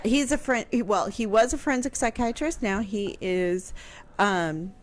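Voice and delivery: ordinary talk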